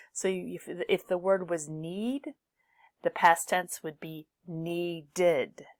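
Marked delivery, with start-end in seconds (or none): 4.5-5.7 "needed" slowly split as nee-did; extra id syllable. Shown as a demonstration. Teacher shows the past tense, "needed" with a separate id syllable. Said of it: "always adds an extra syllable".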